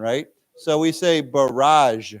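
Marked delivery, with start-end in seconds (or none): 1.2-2.1 BARRAGE said slowly, ending in a zh sound. Shown as a demonstration. Teacher shows how we say the French-style word, zh ending. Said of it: "this G is pronounced with a zh sound".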